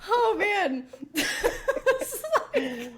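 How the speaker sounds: high, breathless laughter through words; can't stop laughing. Real, not acted. Cracking up at the bit.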